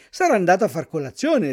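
plain reading voice

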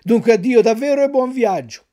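brisk, loud send-off; hurried farewell. A narrator's reading. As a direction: blurt the farewell out in a rush. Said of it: "soggiunse in fretta e furia".

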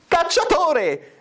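very high, drawn-out summons; mock thundering call. A narrator's reading. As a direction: holler for the huntsman as the stepmother. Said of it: "la matrigna tonò".

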